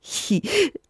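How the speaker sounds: high little sprite laugh; a quick squeaky chuckle; creature giggle. Acted. Rinus laughs at Sophia's question with a high little giggle. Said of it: "lacht Rinus".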